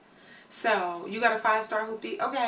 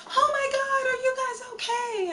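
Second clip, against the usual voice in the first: alarmed woman passerby; 3 dB louder than usual. Retold or imitated. Woman rushing over to a burning car, alarmed high voice. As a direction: imitate a panicked bystander shrieking in a high voice.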